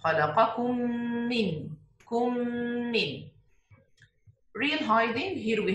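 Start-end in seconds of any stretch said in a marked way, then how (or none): 0.0-3.3 خلقكم من read with the two م merged into one doubled م; merged meem. Shown as a demonstration. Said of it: "pronounce only one ميم مشددة". (إدغام الميم في خلقكم من بميم مشددة)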